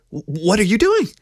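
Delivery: high, stammered startled question; shocked surprise. A narrator's reading. Keo startled as he reaches out, shocked high-pitched question. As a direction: blurt it high, climbing in alarm. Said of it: "she said in shocked surprise".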